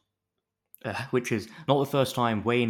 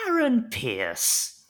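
higher, louder and slow drawn-out cry of his name; swooning call; 12 semitones higher, 5 dB louder, much slower than usual. Pretend. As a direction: swoon over him as he walks in. Fans swooning over Aaron Pierce.

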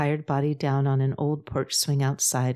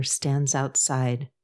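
plain reading voice

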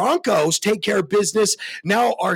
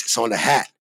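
ordinary talk

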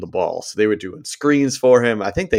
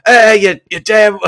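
much higher, much louder mock thug shout; Toby Jones thug yell; 12 semitones higher, 9 dB louder than usual. A bus driver thug yelling obscenities, high and loud. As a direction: bellow it like a drunk British football thug. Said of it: "I get to see Toby Jones like".

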